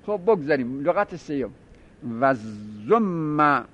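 usual speaking voice

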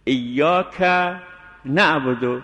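ایاک rising, a gap, then نعبدو; pause after iyyaaka. Shown as a demonstration. Teacher models ایاک نعبدو to avoid کنع. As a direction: separate ایاک from نعبدو with a stop.

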